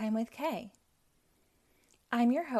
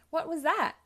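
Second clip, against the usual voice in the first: startled question; 8 semitones higher, 5 dB louder than usual. Acted. Hearing a loud crash.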